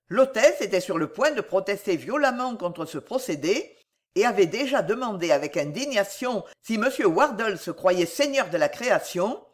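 usual speaking voice

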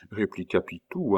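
ordinary delivery

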